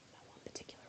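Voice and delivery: hushed whisper; soft secret whisper. Real, not acted. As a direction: breathe it out, no voice. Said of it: "whispering to herself".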